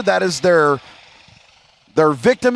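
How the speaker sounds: ordinary delivery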